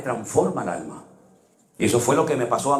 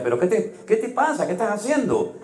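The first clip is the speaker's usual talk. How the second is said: alarmed; 9 semitones higher than usual. Retold or imitated. Father alarmed at daughter.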